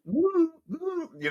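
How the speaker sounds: high, loud laughing aside; laughing at his own dated joke. Real, not acted. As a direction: break into a squeaky laugh.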